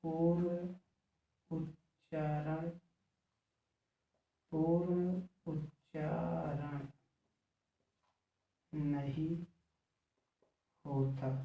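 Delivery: soft, slow word-by-word dictation; measured writing-along pace. Real, not acted. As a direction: dictate slowly, leaving long gaps between words. Dictating while writing on the board.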